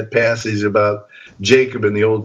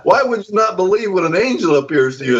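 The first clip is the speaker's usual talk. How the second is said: mock indignant angel; 8 semitones higher than usual. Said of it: "I think what he's saying is".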